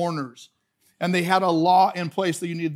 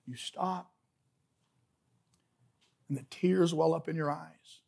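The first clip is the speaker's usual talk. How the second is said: hushed, contemplative; 8 dB quieter than usual. Shown as a demonstration. Preacher describing Jesus silently weeping, hushed and contemplative.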